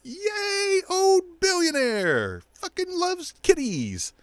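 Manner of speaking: very high, slow, fake-excited cheer; mock cheering fan. Mocking voters, high slow cheering voice. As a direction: gush like a brainless fan. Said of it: "everybody's like".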